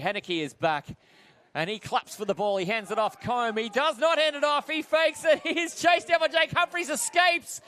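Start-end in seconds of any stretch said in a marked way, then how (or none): 3.2-7.6 urgent, higher running commentary; live play-by-play. Real, not acted. Commentator follows a quarterback being chased, rising excitement. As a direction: pitch climbing with the chase.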